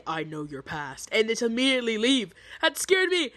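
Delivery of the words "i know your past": low, soft, slow and ominous; a hint of a menacing whisper; lightly played sinister stranger; creepy quiet line. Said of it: "But then you say".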